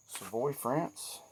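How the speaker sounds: "Savoie, France" drawn out slowly, suh-VOY, rising at the end; slow place name. Shown as a demonstration. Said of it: "not pronouncing it with my redneck dialect".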